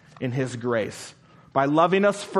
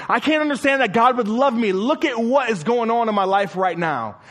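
higher, louder voice of a despairing sinner, hurried at first; 7 semitones higher, 4 dB louder than usual. Retold or imitated. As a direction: voice the hurt doubter pleading. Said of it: "they look at their circumstances and say".

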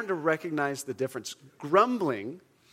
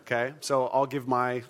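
ordinary talk, nothing marked

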